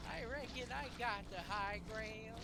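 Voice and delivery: slow, high hammy Southern drawl; bad Southern accent. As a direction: ham up a Southern accent, slowly. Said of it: "in a bad Southern accent".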